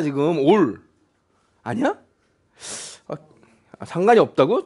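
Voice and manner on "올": "올" with a plain L, no roll; unrolled R. Retold or imitated. Teacher showing the student what was heard, unrolled "올". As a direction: mimic the student's unrolled "올", pitched down. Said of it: "너 지금 이거 불렀잖아".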